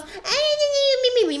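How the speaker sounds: high, squeaky mimicry of whiners; mock whining. Player imitates girls who cannot take a joke, whining mockingly. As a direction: whine it out in an exaggerated silly voice. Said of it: "que elas".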